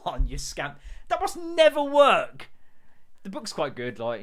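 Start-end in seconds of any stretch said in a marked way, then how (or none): 1.1-2.5 high, loud and slow disbelief; incredulous exclamation. Real, not acted. To the listeners about hostage plans, loud slow disbelief.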